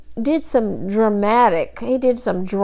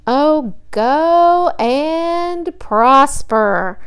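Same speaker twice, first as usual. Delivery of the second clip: higher, very slow, mocking sarcasm; sarcastic; 4 semitones higher, slower than usual. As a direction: drag it out with biting sarcasm. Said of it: "I can just hear the sarcasm in his voice".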